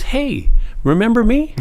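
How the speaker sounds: high, loud playful greeting; imitating Spielberg's greeting. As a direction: greet an old acquaintance with a grin. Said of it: "he goes".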